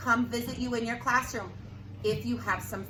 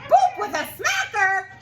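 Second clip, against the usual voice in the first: high, loud puzzled repeat; baffled crowd; 12 semitones higher, 8 dB louder than usual. Acted. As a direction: sound totally baffled. Townspeople baffled.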